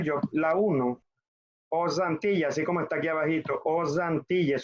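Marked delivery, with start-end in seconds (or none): 1.7-2.5 "Aux Antilles" run together with a z; liaison with Z. Shown as a demonstration. Teacher clarifies for a student, pronounced with z liaison. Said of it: "suena como z". 3.6-4.6 "Aux Antilles" with audible z between words; liaison with Z. Shown as a demonstration. Teacher points at written example, pronounced with z liaison. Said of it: "suena como z".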